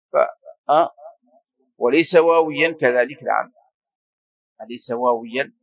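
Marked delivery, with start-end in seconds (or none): none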